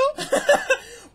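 sudden very high, loud burst of laughter; high squeal laugh. Real, not acted. Streamer cracking up at his own near miss, high laugh.

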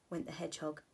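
usual speaking voice

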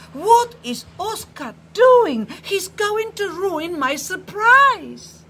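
high, loud outburst on the edge of tears; furious. A narrator's reading. Phyllis fuming at Oscar for ruining his surprise, loud and tearful. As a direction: burst out, close to crying. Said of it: "And he is furious".

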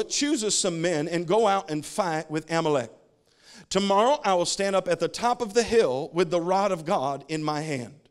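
slow, emphatic pulpit reading; scripture reading. Real, not acted. Preacher reading Moses and Joshua to the church, declaimed scripture. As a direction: read the verse out boldly, phrase by phrase. Reads scripture aloud to congregation.

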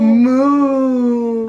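loud drawn-out moo; mock cow moo. Shown as a demonstration. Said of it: "what sound does a cow make?".

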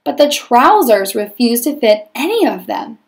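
lively read-aloud storytelling voice; story narration. A narrator's reading. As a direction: read it like a picture book to a class. Teacher reads story aloud.

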